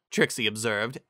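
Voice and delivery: ordinary delivery, nothing marked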